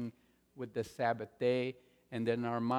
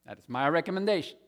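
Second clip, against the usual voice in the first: usual speaking voice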